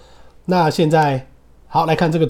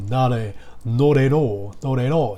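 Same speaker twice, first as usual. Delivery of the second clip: "not at all" in a light British accent, round open "all"; slower than usual. Shown as a demonstration. Teacher demonstrating British pronunciation for class, British accent. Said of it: "这是英式".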